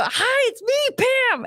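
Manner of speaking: very high, bright sing-song greeting; Pam's voice. Retold or imitated. Imitating Pam greeting Abby, very high sing-song. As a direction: chirp it like a quirky sitcom character. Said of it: "and she'd go".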